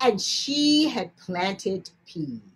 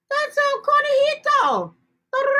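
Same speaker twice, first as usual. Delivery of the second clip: very high, louder gasp of recognition; excited discovery; 5 dB louder than usual. Acted. Girl recognizing the rabbit, excited high exclamation. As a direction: burst out in delight.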